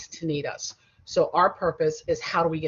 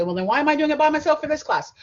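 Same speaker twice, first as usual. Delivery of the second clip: higher, louder mock student complaint; protesting student; 9 semitones higher, 6 dB louder than usual. Teacher voicing her class's likely objection, mock protest.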